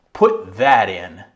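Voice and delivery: loud, slow, emphatic statement; declarative, going down. Shown as a demonstration. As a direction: declare it with force and let it drop. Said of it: "declarative and to go down".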